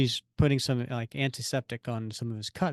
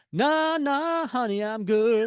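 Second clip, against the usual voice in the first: high, louder comic refusal, drawn out at the end; mock brush-off; 8 semitones higher, 3 dB louder, slower than usual. Co-host parodying Peter shuffling Mia out, high mock brush-off. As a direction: wave her off in an exaggerated high voice. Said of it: "That was Peter going".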